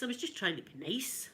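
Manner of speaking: usual speaking voice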